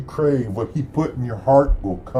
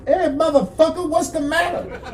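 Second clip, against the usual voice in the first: much higher, squeaky cartoon voice. Poem's narrator greeting Santa in a much higher squeak. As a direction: squeak it out like a startled cartoon character. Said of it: "I got out of bed and said".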